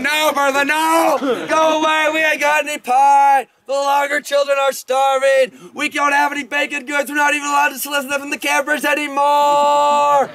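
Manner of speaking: high, frantic yelling with stretched-out words; hammed-up desperate plea, pitched high; panicked pleading. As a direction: wail excuses to fend someone off. Teller acts out pleading with Bertha.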